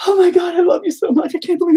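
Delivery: very high, loud mock fangirl squeal; gushing fan glee. Imitating a fan meeting a star, hysterical high-pitched glee. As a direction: gush in a squeaky frenzy until words give out. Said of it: "practically explodes with masturbational glee".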